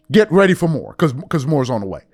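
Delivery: ordinary talk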